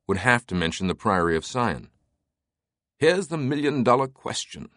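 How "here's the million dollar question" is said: a touch of amusement, pitched higher; lightly voiced amused character, lifting the opening; Teabing. A narrator's reading. Teabing putting the big question to Langdon, lightly amused. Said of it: "still chuckling".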